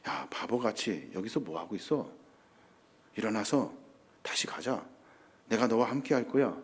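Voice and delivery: very soft, low, tender chiding; God speaking quietly and close by; still small voice. Acted. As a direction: coax a runaway gently in a near murmur. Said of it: "조용히 세미하게 엘리야에게 말씀하시는".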